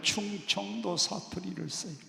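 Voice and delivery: ordinary talk, nothing marked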